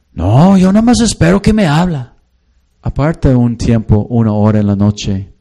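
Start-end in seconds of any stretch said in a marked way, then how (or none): 0.1-2.2 higher, put-on voice of an excuse-making husband; husband's lame excuse. Pastor voicing a husband making excuses to him, higher put-on whine. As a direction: shrug it off like a lazy husband.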